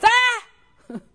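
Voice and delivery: sudden high, loud yelp of alarm; holler. Retold or imitated. Someone hollering when the computer shuts off, loud alarmed yell. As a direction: cry out as the screen goes blank. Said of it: "somebody hollers".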